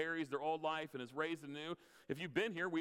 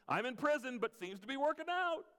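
mock cheerful Paul; 8 semitones higher, 3 dB louder than usual. Mocking Paul's cheerful attitude.

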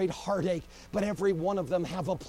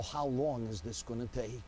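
soft, lower sorrowful plea; 8 semitones lower, 8 dB quieter than usual. Acted. Mighty men of God look up to heaven, sorrowful plea. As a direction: sigh it out like a mourner. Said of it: "say in sorrow".

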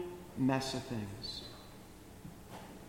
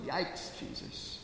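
breathy, much softer aside in a whisper; whispered yikes; 3 semitones lower, 10 dB quieter, slower than usual. Pretend. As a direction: mutter it under your breath, shocked. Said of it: "And I just go".

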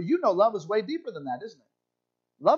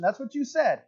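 ordinary delivery

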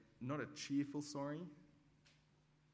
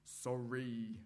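a grudging, sulky sorry; angry sorry. Shown as a demonstration. Preacher demonstrating a child's angry sorry, grudging. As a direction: spit out a resentful sorry. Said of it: "sounds a bit like this".

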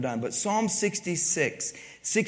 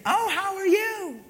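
self-absorbed acquaintance. Retold or imitated. Self-absorbed person starting a conversation with the speaker, high mock greeting. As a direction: imitate a self-centred talker greeting someone in a much higher voice. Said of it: "they'd even start the conversation".

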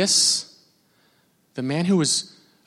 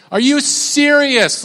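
higher, slow disbelieving question; incredulous; 9 semitones higher, slower than usual. Retold or imitated. Fan offended at being ignored, slow and higher. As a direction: act hurt disbelief. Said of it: "I'm like".

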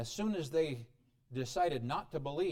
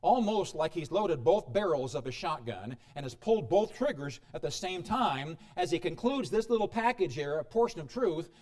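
ordinary delivery

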